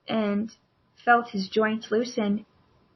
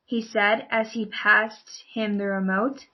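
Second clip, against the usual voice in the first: usual speaking voice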